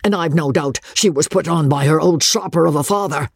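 high, rising anger building to a rant; father raging at his son's choice, high and building; gathering wrath. Acted. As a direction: build from irritation to fury. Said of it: "with gathering wrath".

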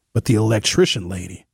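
usual speaking voice

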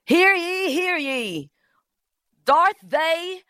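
put-on high ringing old-English proclamation; hammed-up town crier; mock town crier. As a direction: call it out like a medieval herald.

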